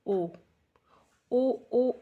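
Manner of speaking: put-on short yelping "ओ, ओ" like a kid being beaten; playful wail; mock cry of pain. Shown as a demonstration. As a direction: act out the child's yelp of "ओ". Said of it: "तो आप चिल्लाएंगे ना".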